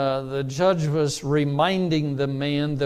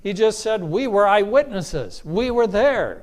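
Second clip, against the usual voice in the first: higher, louder preaching build; emphatic preaching; 6 semitones higher, 4 dB louder than usual. Real, not acted. Preacher pressing the congregation on Peter's words. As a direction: drive the point home, higher and louder. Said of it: "preaching at full force".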